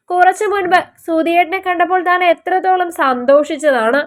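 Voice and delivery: usual speaking voice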